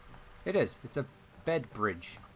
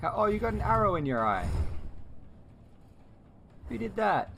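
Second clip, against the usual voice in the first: higher, rising alarm at the wound; shocked sympathy; 9 semitones higher than usual. Real, not acted. Streamer spots the arrow in the dragon's eye, shocked and sympathetic. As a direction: gasp in dismay at the hurt creature.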